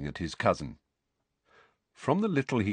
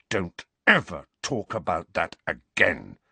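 snarl. A narrator's reading. Boy warning his cousin off, snarling. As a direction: snarl it without raising the pitch. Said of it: "Harry snarled".

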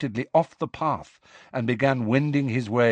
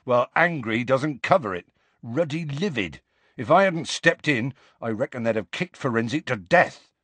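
put-on emphatic rustic accent; Hagrid, grimly emphatic; 3 semitones higher than usual. Hagrid describes the centaurs' fury, grimly emphatic. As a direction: tell it with rough country force.

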